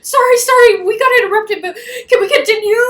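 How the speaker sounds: high, loud flustered imitation; hammed-up panting apology with a laugh; mock breathlessness. Her running after Devling to resume their talk, mock out of breath. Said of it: "she's, like, out of breath".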